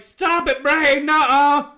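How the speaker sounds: loud, high yell with a drawn-out ending; mock protesting yell. Host yelling back at his bullying brain, protesting. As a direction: scold your own brain at full volume. Acted argument with own brain.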